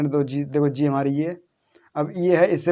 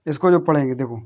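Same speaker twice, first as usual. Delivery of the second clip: ordinary delivery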